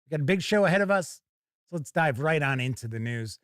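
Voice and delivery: ordinary talk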